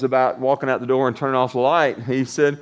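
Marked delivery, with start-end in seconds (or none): none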